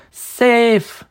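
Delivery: sharp high snap; snapped. Acted. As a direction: bite the word off. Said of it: "she snapped".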